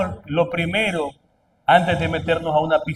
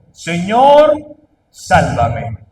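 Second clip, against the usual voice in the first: retold cry; 6 dB louder, much slower than usual. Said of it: "Entonces gritó".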